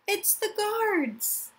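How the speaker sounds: high, slow frightened call; alarmed cry. Acted. Child wakes to guards searching for them, frightened cry. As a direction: warn everyone the guards are coming. Child panics at night raid.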